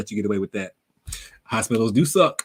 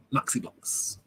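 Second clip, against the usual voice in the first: hushed, almost voiceless repeat; savouring the name quietly; 9 dB quieter, much slower than usual. Real, not acted. Streamer trying out a viewer's name, savouring it quietly. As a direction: say it softly, as if to yourself.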